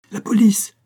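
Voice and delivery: high, loud call; crying out. A narrator's reading. Voices announcing the police's arrival, crying out. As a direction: shout the alert across the room. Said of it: "crièrent des voix".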